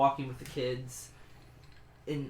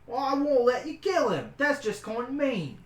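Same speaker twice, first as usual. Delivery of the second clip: high, loud, stubborn cook; 3 semitones higher, 5 dB louder than usual. Acted. The cook argues with the adventurer about the rooms, high and insistent.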